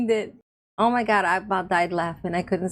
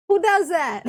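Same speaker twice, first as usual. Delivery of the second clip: much higher and louder, delighted disbelief; amazed exclaim; 8 semitones higher, 4 dB louder than usual. Retold or imitated. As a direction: throw up your hands in amazement. Retelling reaction to the video.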